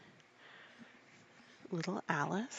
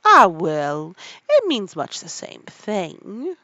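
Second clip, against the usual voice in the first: much louder, booming grand Duchess; 15 dB louder, slower than usual. A narrator's reading. The Duchess answering Alice, booming and slow. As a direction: boom like a pompous duchess.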